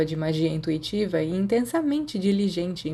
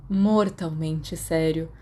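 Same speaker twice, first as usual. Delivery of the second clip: slow mock solemnity; much slower than usual. Sirius insists, teasing.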